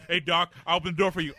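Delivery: barking sergeant yell; loud scolding. Retold or imitated. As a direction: chew him out, loud. Marine gunny scolding a Navy doc.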